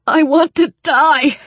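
high, loud, desperate outburst; fierce anguish. Acted. Wounded Daniel breaks down before Alex, fierce anguish. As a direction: hurl it out in despair. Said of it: "he said fiercely".

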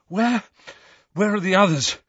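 high, anxious gasp; gasped question. A narrator's reading. Harry finding his friends missing, gasped. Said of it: "gasped Harry".

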